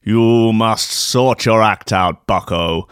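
slow, low, hammed-up spectral voice; ghostly warning. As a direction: warn them solemnly like a haunting spirit. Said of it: "ghostly apparitions form".

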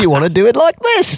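high-pitched meddling know-all voice; comic character correcting someone, pushed high; Harry Enfield. Acted. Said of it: "He used to have this character that would say".

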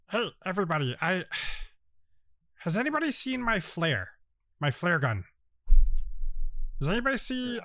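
small gnome voice, leaping high on questions; little jungle gnome. Acted. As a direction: ask around hesitantly as a little gnome.

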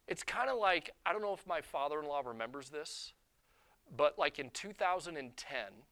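ordinary talk, nothing marked

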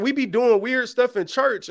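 ordinary delivery

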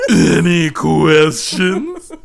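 very slow, stretched mock question; drawn-out mock question. Host echoing the question slowly, teasing.

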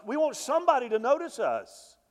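climbing, urgent sermon voice; preaching at full force. Acted. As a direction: push the plea hard like a revival preacher. Preaching peak, pleading.

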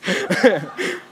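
real chuckle that trails down; short laugh. Real, not acted. Laughs at own joke.

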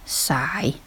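breathy spoken sigh, whispered; mock weary whisper; whispered sigh word. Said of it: "And mine was".

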